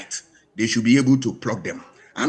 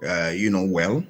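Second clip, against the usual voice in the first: normal talking voice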